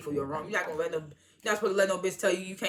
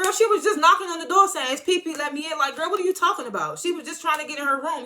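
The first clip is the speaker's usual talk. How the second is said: high, loud, incredulous; high, loud exasperated disbelief; exasperated reaction; 9 semitones higher, 4 dB louder than usual. Retold or imitated. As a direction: pitch up in disbelief at her. Reacting loudly to Jocelyn's complaint.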